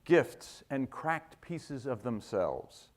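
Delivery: poetry reading. Acted. Poet sharing a poem with the conference, measured poetry reading. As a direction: let each short phrase land, then pause. Reads own poem aloud.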